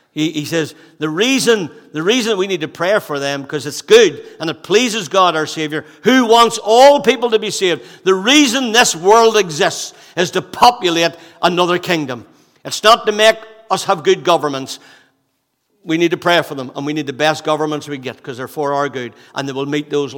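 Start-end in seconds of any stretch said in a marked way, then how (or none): 6.0-14.2 rising, louder preaching; building pulpit fervor. Acted. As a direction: lift into fervent preaching. Preaching rise.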